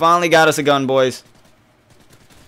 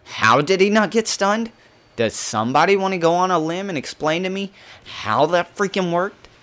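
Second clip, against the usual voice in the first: high-pitched, incredulous protest; exasperated disbelief; 7 semitones higher than usual. Real, not acted. Gamer asking how he did not get stunned, exasperated. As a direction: demand an explanation in a high, exasperated voice.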